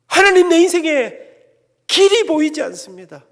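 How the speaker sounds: loud, high desperate plea; anguished cry to God. Retold or imitated. Young man crying out to God in despair. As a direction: cry out to God from a dead end.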